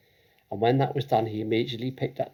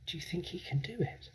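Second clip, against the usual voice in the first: softer, higher hushed question; whisper; 7 semitones higher, 10 dB quieter than usual. Acted. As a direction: hush it to a friend. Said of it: "she whispered to Lavender".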